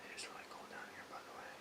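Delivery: hushed, rushed whisper; nervous night vlog whisper. Real, not acted. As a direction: whisper it fast, nervous in the dark. Ghost hunt, alone in basement.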